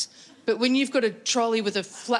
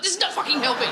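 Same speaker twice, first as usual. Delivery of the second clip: exasperated; 6 semitones higher, faster than usual. Retold or imitated. Retold exasperated thought.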